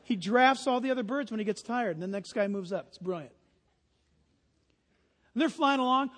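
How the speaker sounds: usual speaking voice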